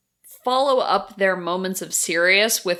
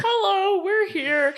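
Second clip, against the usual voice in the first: high, chirpy mock magical girls announcing themselves; mock cheerful hero girls; 11 semitones higher than usual. Host mocking the pointless Yes Precure girls, high chirpy mock voice. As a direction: pipe it up like cartoon heroines showing up late.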